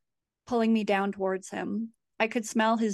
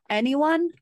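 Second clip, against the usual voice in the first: loud, high call for anyone; 6 semitones higher, 6 dB louder than usual. A narrator's reading. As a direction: shout into the dark hall. Calling for help in hall.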